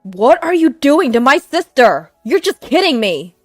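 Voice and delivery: furious. Acted. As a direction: roar at him in rage. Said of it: "My brother was furious".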